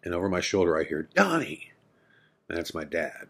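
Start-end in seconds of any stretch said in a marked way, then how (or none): 1.1-1.7 calling out. Retold or imitated. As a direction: call his name sharply across the room. Dad calling from behind.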